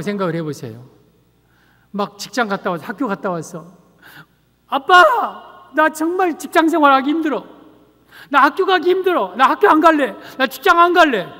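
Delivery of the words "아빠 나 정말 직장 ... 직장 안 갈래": high-pitched, whining plea to Dad; distressed child complaining. Acted. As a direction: play a child refusing to go, voice raised high.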